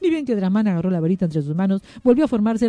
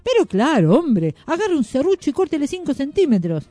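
higher-pitched brisk reassurance; assured wand-maker; 7 semitones higher than usual. A narrator's reading. Wand-maker ordering the magician to saw, assured. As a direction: brush the doubt aside. Varitero brushes off the doubt.

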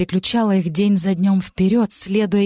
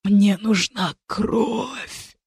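very slow, strained demon rasp; 3 semitones higher, much slower than usual. A narrator's reading. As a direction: drag it out like a starving monster. Crazed demon begging for blood.

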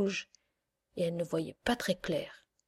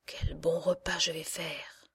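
slow unvoiced whisper, savouring it; murmured; 2 semitones lower, slower than usual. Witch gloating over the sleeping children, whispered. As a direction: breathe it out greedily, slowly. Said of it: "elle murmura".

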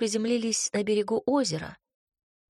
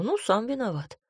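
ordinary delivery

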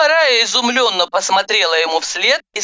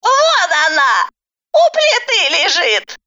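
put-on old granny; 11 semitones higher, 3 dB louder than usual. A narrator's reading. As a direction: point it out in a high old woman's voice. Granny character. (высокий бабушкин голос)

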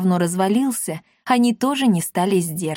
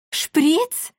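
high, rising startled question; alarmed cat, voice jumping up; frightened yelp; 9 semitones higher than usual. A narrator's reading. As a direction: squeak it in alarm. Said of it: "испуганно завопил Акаций".

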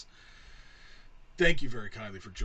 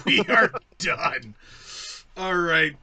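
laughing; 5 semitones higher, 11 dB louder, slower than usual. Real, not acted. Laughing through sign-off.

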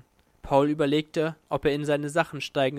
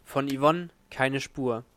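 usual speaking voice

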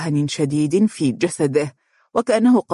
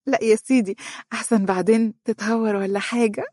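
higher, playful female voice; amused; 6 semitones higher than usual. A narrator's reading. Wife stepping away from her flirting husband, laughing refusal. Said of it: "ضحكت وهي تبتعد عنه وقالت".

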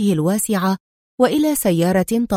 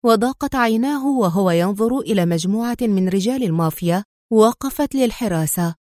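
usual speaking voice

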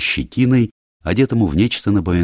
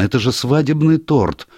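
higher-pitched excited exclamation; sudden realisation; 9 semitones higher than usual. A narrator's reading. Niece suddenly tells doctor the poison's source, excited realisation. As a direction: blurt it out as it dawns on you.

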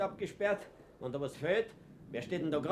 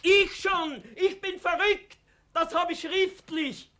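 defiant high yell, slowing at the end; loud show of being crazy to the party official; defiant mock-crazy shout; 14 semitones higher, 5 dB louder, slower than usual. Fuchs defies the party man.

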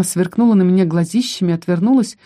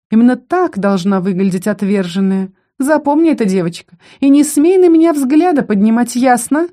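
higher-pitched contemptuous warning; scornful character voice, rising in pitch; haughty female bully; 6 semitones higher, 4 dB louder than usual. Acted. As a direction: put a girl in her place with a raised voice.

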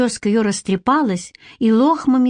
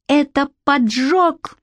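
higher, slow cry of discovery; shout; 6 semitones higher, slower than usual. A narrator's reading. Boy realises to Shnyr it is arson, shouting. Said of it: "крикнул я".

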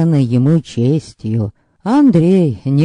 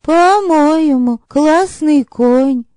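put-on high, drawn-out girlish musing; slow and high, admiring the horse; girl character; 12 semitones higher, slower than usual. Girl giving her opinion of a horse, high and slow.

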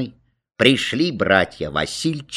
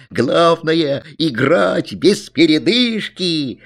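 high, drawn-out rallying cry; excited child's declaration; boy shouting; 6 semitones higher, slower than usual. A narrator's reading. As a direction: call out slowly and emphatically. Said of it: "кричал Алян".

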